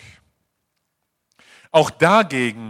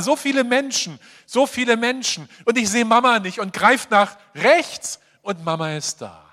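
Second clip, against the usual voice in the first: ordinary talk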